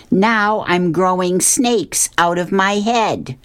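high, drawn-out wail of complaint; mock scream. A narrator's reading. Fish complaining to Megan of side effects, mock scream. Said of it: "Then she screams more".

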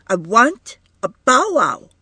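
put-on high, loud little girl's demand; a small child wanting it now; toddler demanding. A narrator's reading.

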